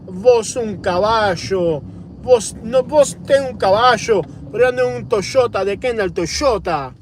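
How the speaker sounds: high, sing-song Argentinian Spanish with "sho" sounds; Argentinian Spanish accent. Acted. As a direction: play a fancy Argentinian guy, accent laid on thick. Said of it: "the Argentine guy's like".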